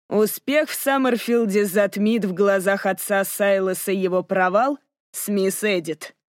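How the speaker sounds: plain reading voice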